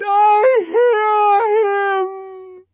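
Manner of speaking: very high, loud, slow cry; a woman crying out in frenzy; ecstatic cry. Acted. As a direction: cry out in rapture. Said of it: "she cried".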